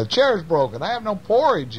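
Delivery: high, plaintive little-bear voice; storybook baby bear complaining; upset baby bear. Acted. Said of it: "says Baby Bear".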